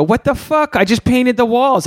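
high, indignant squeak of defense; a hammed-up defensive husband; mock outraged self. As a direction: play your own huffy overreaction. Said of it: "I go".

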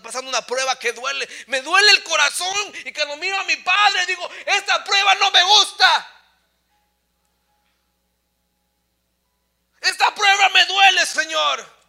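loud, high cry from the pulpit; impassioned preaching. Real, not acted. Preacher confesses that the family's trial hurts, impassioned preaching. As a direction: shout the pain out to the church, voice pitched high.

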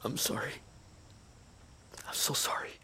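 quiet grief at a friend's death; grieving apology. Acted. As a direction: barely get it out over his body. Grieving over dead friend.

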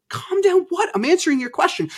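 high, exasperated retort, retelling an argument; indignant protest. Retold or imitated. Husband retelling his answer to his ex telling him to calm down, indignant.

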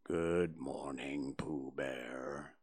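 very low, slow and soft donkey; gloomy. A narrator's reading. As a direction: sigh it out like a sad old donkey. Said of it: "said Eeyore gloomily".

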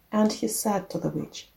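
ordinary delivery, nothing marked

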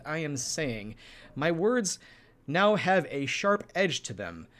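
very precise, sharp-edged consonants on "crisp" and "edge"; crisp enunciation. Shown as a demonstration. Co-host showing listeners sharp edges to the words, crisply enunciated. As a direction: make every T and P in "crisp" and "precise" land cleanly. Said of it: "I could be very precise".

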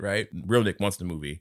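ordinary talk

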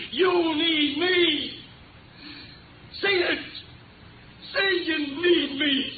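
slow, high, intense pleading threat; menacing demand. Acted. Gunman forcing Franny to say she needs him, slow menacing demand. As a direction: drag each word out, gun in hand.

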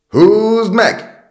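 long, high, very loud haul call; full-voiced dockside yell; sailors' shout. A narrator's reading. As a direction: holler it out with a drawn-out first word. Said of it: "kiáltották".

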